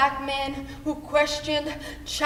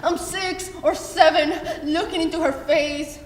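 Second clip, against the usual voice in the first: usual speaking voice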